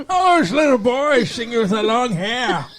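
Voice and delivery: put-on high, fussy old lady voice; grandma voice. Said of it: "Sound like a grandma".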